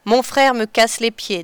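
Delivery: usual speaking voice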